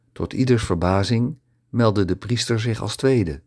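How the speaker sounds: ordinary delivery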